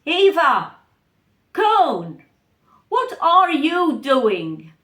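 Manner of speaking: put-on loud, stern father calling out to his kids; a booming father's voice. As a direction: call the children like a suspicious dad. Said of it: "And he asked".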